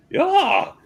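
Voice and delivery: high, loud delighted yeah; excited yeah. Retold or imitated. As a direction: burst out with pleasure. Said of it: "like that".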